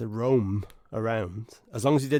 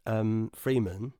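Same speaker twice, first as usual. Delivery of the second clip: ordinary talk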